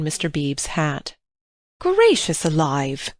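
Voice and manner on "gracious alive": put-on slow, startled exclamation of an older lady; a hint of a scandalised mother; shocked cry. A narrator's reading. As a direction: gasp it out in dismay. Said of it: "cried Missus Honeychurch".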